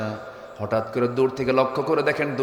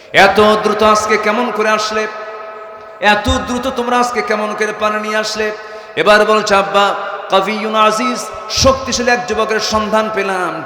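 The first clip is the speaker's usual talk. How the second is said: high, loud sermon cry; impassioned high-pitched preaching; full-force preaching; 10 semitones higher, 9 dB louder than usual. Acted. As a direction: raise the voice and proclaim it to the crowd.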